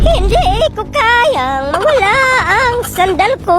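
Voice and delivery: high, slow hammed-up sob; mock crying. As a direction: wail over the lost sandal. Crying over a lost sandal.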